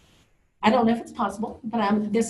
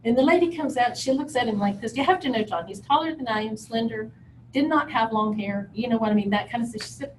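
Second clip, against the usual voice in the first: normal talking voice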